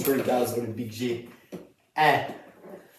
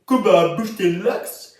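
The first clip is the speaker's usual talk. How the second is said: loud, higher hyped-up hook; hype ad-lib; 5 semitones higher, 6 dB louder, slower than usual. Shown as a demonstration. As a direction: shout it like a hook. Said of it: "c'est aussi le mec qui a fait".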